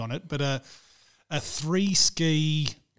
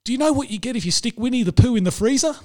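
imitating; 6 semitones higher, 6 dB louder, faster than usual. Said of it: "He goes".

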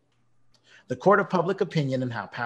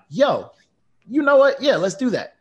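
mock-hyped yes, much higher and louder; 11 semitones higher, 8 dB louder than usual. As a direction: act out jumping on board with excitement. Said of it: "Then I'm like".

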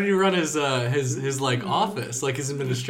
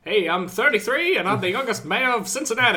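high, loud hammed-up Ohio mayor voice; Cincinnati accent; 9 semitones higher, 6 dB louder than usual. Podcast host mocks a young mayor in a Cincinnati accent. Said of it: "Is that, is that Cincinnati accent?".

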